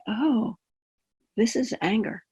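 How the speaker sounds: hushed, curious self-observation; silent noticing. Acted. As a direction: think it aloud softly, as a private discovery. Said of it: "I could notice silently".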